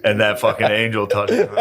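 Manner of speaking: ordinary talk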